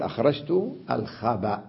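الخبأ as al-khaba', ending on hamza; الخبأ with glottal stop at the end; pause with vowel transfer. Shown as a demonstration. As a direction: open the ب of الخبأ with a, then stop. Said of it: "نقلت حركتها إلى الباء قبلها".

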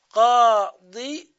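قاضي stretched very slowly, loud, rising end; slow demonstration. Shown as a demonstration. Teacher showing how tanween joins قاضي. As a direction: hold the kasra in قاضي. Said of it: "بكسرة الضاد".

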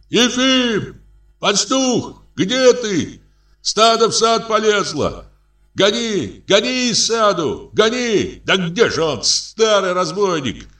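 high, long-drawn hollering; cries from shore. A narrator's reading. Villagers calling the shepherd to drive out the herd, hollering. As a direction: call out urgently on a very high pitch. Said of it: "слышится с берега крик".

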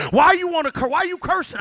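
high, loud challenge; confronting questioner. Acted. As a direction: press the youth hard for an answer. Acted questioning of angry youth.

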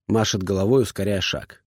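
ordinary delivery

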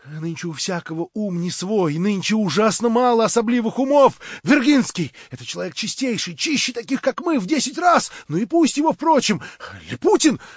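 high, loud feverish rant; frenzied tirade. A narrator's reading. Verkhovensky raving at Stavrogin in a frenzy.

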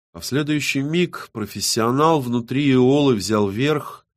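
ordinary delivery

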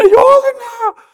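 father begging the crowd; a father's anguished cry; desperate cry. Retold or imitated. Father cries for help.

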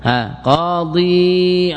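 قاضي sung out slowly, the final ya held; drawn-out full form. Shown as a demonstration. Teacher sings قاضي for the class, long and slow. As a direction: draw out قاضي to show the ya. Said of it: "teacher shows the full form".

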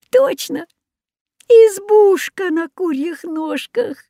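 high, drawn-out exclamation; amazed recognition. A narrator's reading. Children come upon the hut on chicken legs, amazed. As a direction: announce the amazing discovery, pitched high.

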